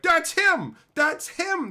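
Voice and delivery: loud, high cry of recognition; accusing shout. Retold or imitated. Online crowd pointing out the culprit, accusing shout.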